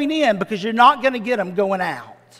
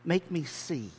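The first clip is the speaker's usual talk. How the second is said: quiet plea; 6 semitones lower, 13 dB quieter than usual. Acted. Beggar's plea to Jesus.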